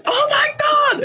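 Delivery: high, squealing shout of joy; ecstatic shriek. Retold or imitated. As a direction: scream it like the best news of your life. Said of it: "and I'm like".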